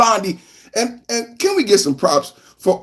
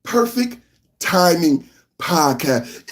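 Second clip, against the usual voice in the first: said real slow; slow drawn-out show name; 4 semitones lower, slower than usual. Shown as a demonstration. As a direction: draw the name out slowly. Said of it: "say it real slow".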